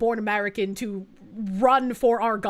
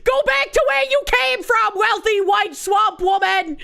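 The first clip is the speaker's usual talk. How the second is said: shouted high mock accent, slowing at the end; hammed-up Chinese accent yelled in jest; 8 semitones higher, 4 dB louder than usual. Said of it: "with her thick-ass accent saying".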